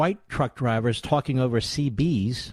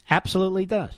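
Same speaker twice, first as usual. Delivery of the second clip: usual speaking voice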